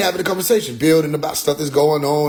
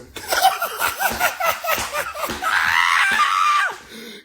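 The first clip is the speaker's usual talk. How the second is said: big laugh. Real, not acted. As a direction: burst out laughing. Laughs at own joke.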